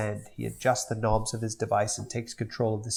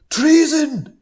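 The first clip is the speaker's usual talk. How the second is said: much higher, louder cry; high indignant shout; outraged yell; 10 dB louder than usual. Acted. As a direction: shriek it like a betrayed tyrant baby. Stewie yells.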